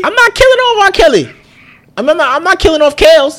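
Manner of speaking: high, loud insistent denial; loud protest. Real, not acted. Heated denial in debate.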